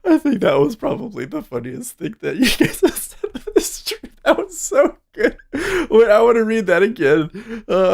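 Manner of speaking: high, laughing voice; laughing while talking. Real, not acted. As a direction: talk through the giggles.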